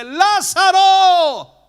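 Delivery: llamado muy lento, fuerte y agudo; LÁZARO gritado a la tumba, alargado; grito llamando. Retold or imitated. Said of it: "alza su voz y dice". (Jesús llama a Lázaro con un grito prolongado)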